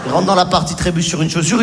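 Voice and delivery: normal talking voice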